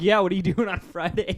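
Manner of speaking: loud, high, laughing come-on; flirty line. Retold or imitated. Dan flirts with the clerk, loud, high, laughing. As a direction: play a cheeky flirt hitting on her. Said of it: "And you're like".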